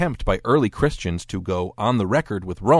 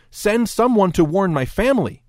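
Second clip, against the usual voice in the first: high-pitched, urgent plea; lightly acted begging; pleading; 10 semitones higher, 4 dB louder than usual. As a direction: plead for help in a raised pitch. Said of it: "The man pleads".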